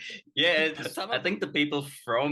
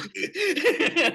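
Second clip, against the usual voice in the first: burst of high laughter; 6 dB louder than usual. Real, not acted. Laughing at own story.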